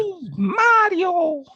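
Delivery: very high, loud, drawn-out squeal; Mario. Shown as a demonstration. Podcaster retrying a game hero, high cartoon voice. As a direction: leap high and stretch the name. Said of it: "That is closer".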